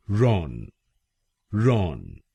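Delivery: "रॉन" said slowly as the first syllable; syllable demo. Shown as a demonstration. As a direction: give "रॉन" alone, clearly. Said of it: "हम बोलेंगे". (पहला शब्दांश "रॉन" साफ़ बोलिए)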